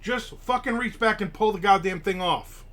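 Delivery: put-on snapping orders at girlfriend, fed up; angry demand. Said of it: "I was so pissed at her".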